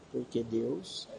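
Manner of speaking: soft, low, rising at the end; feed the fragment softly, leaving it open; quiet prompting. Shown as a demonstration. Giving a verse piece for the child to repeat, quiet prompting.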